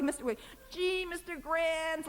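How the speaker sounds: put-on high, slow, shy little-girl voice; Mary Tyler Moore. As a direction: play the bashful young woman, slow and high. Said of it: "like that".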